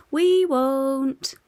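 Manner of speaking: loud, high chorus; chorus of kids. A narrator's reading. Kids in bed promising Santa, in chorus. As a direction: answer together in one voice. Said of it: "they chorus from the bed".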